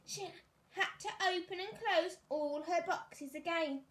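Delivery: ordinary delivery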